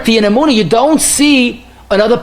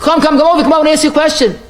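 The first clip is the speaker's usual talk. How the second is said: calling out; 7 semitones higher, faster than usual. Acted. Grocer calling after fleeing debtor.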